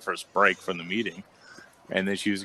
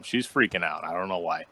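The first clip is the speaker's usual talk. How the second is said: ordinary talk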